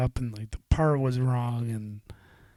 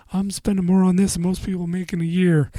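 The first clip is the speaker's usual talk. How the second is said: higher, louder mock complaint; mocking customer; 6 semitones higher, 5 dB louder than usual. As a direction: mimic the whiny customer.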